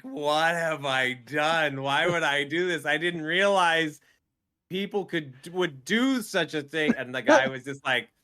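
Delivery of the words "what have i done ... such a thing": high, loud mock despair; exasperated regret. Streamer sweating through endless exercise sets, exasperated. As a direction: wail it in comic disbelief at yourself.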